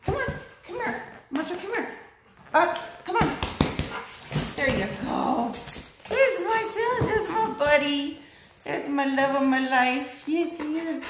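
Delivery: high, soft sing-song call to a pet; coaxing the dog. Real, not acted. Praising her dog.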